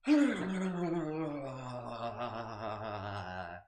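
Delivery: long, shaken-out voiced sigh; drawn-out, silly exhale; big exhale. Shown as a demonstration. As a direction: let a big wobbly sigh fall all the way out. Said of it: "Big exhale, shake it up".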